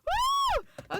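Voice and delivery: high, loud cheer of joy; ecstatic whoop. Real, not acted. Celebrating the news about the case file, whooping. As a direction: whoop as loud as you can. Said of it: "explain my excitement right now".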